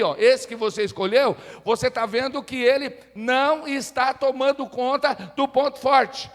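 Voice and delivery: loud, insistent pulpit delivery; full-force preaching. Real, not acted. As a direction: proclaim it forcefully to the whole church.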